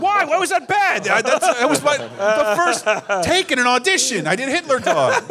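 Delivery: high, comic outrage; mock indignant protest. Comic defends his failed first audition take, mock indignant. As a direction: protest high-pitched, half laughing by the end.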